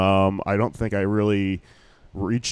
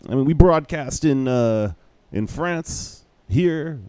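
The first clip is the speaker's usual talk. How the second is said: mock Israeli accent; 6 semitones higher, slower than usual. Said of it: "this guy with this thick Israeli accent".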